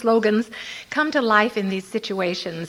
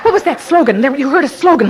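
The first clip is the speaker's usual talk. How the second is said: normal talking voice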